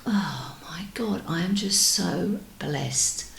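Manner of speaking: hushed, breathless whisper of wonder; soft whispered blessing; awed whisper. Retold or imitated. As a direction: whisper it as if struck by gratitude. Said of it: "it just makes you sort of go".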